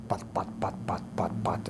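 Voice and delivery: low, unvoiced rotor puffs; imitated chopper thud. Storyteller mimics a helicopter heard in the distance, soft rotor imitation.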